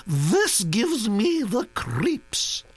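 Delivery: put-on high, scornful aristocratic old lady; disapproving old duchess. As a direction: scoff at the paintings grandly. Duchess looks at painting.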